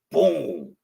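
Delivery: ordinary talk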